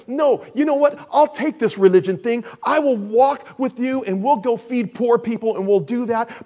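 acted refusal. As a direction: push back forcefully as a reluctant follower. Voicing disciples refusing hard words.

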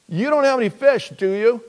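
high, loud holler; called-out question. Acted. Preacher playing Jesus asking fishermen, high loud calling voice. Acts shouted question across water.

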